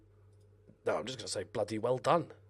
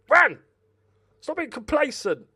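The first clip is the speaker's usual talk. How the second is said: loud, fast yell of the name then higher scolding; shout at player; 12 semitones higher, 9 dB louder than usual. Real, not acted. Gamer yells at Varane to stop being complacent. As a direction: bellow at the lazy defender. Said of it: "Can I just shout something at Varane?".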